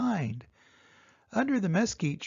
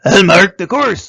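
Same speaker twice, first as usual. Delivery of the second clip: squawking bird; 3 semitones higher, 18 dB louder than usual. A narrator's reading. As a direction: squawk it like a roadrunner. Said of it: "squawked Roadrunner".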